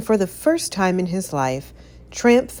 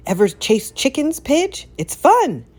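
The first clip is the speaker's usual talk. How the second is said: eager excitement; 9 semitones higher, 4 dB louder than usual. A narrator's reading. Tramp eager about chasing.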